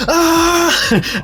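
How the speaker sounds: high, short yelp of panic; high-pitched pilot's panicked scream. Acted. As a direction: cry out as the ship goes down. You just hear.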